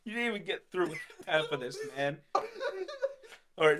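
ordinary talk

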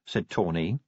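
plain reading voice

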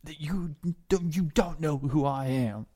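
strained, slowed-down angry demand; acted fury, forcing out the words; getting mad. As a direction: mad prisoner demanding release. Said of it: "he starts getting mad".

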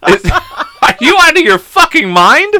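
high-pitched, incredulous scolding; laughing outrage. Real, not acted. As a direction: scold in shocked disbelief, voice shooting up. Outraged at co-host's joke, laughing.

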